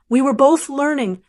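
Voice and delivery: usual speaking voice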